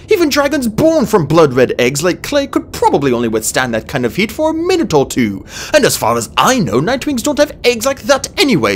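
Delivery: high, loud, incredulous babbling; frantic scientific rant; agitated outburst. A narrator's reading. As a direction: pour out objections in flustered disbelief. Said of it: "Starflight burst out".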